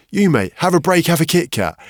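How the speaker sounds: high and loud cheeky jingle delivery; mock advert slogan. Teammate teasing him with the ad line, loud cheeky slogan. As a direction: belt it like the chocolate ad.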